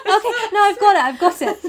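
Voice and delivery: ordinary talk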